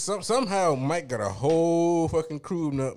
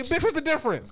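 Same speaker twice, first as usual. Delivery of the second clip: exasperated retort; 4 dB louder than usual. Retold or imitated. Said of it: "So I was like".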